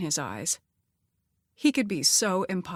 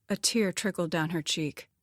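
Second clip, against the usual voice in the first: plain reading voice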